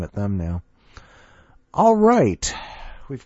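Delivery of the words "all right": bright, punchy all right; excited. Real, not acted. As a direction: announce it with energy. Host wraps up, enthusiastic call.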